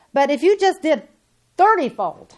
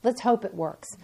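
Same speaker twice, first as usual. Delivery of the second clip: mock doubtful believer; 4 semitones lower, 5 dB quieter than usual. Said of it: "They step into something ... and say".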